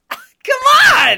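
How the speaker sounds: loud high burst of laughter into a shout; laughing shout. Real, not acted. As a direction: yell it out mid-laugh. Laughing outburst at friend.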